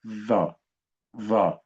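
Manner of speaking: short, heavy interdental TH in "ظا"; tongue between teeth. Shown as a demonstration. Teacher has children repeat the sound, heavy interdental ظا. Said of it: "يلا بص للساني".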